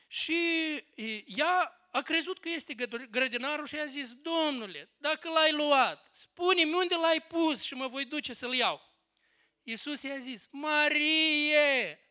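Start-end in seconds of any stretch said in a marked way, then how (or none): 4.1-8.9 higher, drawn-out acted plea; imitated earnest appeal; Mary's plea. Mary begs the supposed gardener. 10.5-12.0 loud, high, drawn-out call; acted ringing call; Jesus calling out. Jesus calls Mary by name.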